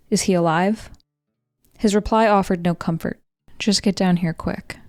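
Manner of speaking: plain reading voice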